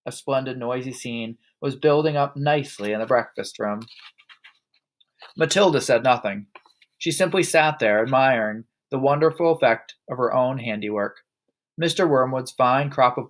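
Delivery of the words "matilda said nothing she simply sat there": usual speaking voice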